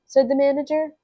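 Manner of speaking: plain reading voice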